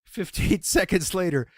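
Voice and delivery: normal talking voice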